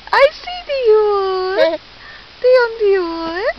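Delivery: high, slow singsong; playful baby talk. Pretend. Mother finding her baby in peekaboo, high playful singsong. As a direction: coo the name in long swoops.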